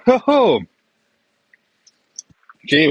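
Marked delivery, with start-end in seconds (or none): 0.1-0.6 high loud laughing hoot; delighted hoot. Real, not acted. Guy reacting to a strong bourbon, whooping laugh. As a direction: whoop with pleasure at the sip.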